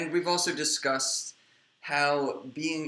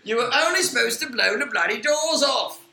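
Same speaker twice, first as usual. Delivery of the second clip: loud, high cockney film-star bark; hammed-up Michael Caine, belting the line; over-the-top movie quote in a put-on voice; Michael Caine impression; 8 semitones higher, 8 dB louder than usual. Michael Caine impression quoting films.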